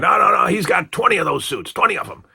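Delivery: high, insistent cover-up; Blassie. Retold or imitated. Manager covering up for his wrestler, imitated high protest. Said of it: "trying to cover it up by going".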